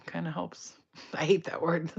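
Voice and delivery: ordinary delivery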